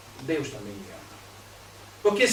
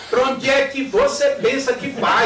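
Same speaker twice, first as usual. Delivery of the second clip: louder, slow suspicious demand; angry mother; 5 semitones higher, 8 dB louder, slower than usual. Retold or imitated. Mother confronting daughter going out, loud slow challenge. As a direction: stop her at the door, louder and slow.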